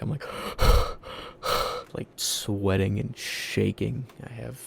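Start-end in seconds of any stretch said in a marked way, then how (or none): 0.3-1.9 sharp loud gasp for air; panicked gasp. Retold or imitated. Alone in bed at night, a loud panicked gasp. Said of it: "breathing heavy as fuck".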